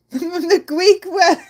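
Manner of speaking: laughing through the words; laughing retry. Real, not acted. Streamer laughs at tripping on R's, laughing. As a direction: giggle it out. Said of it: "I can't pronounce my R's".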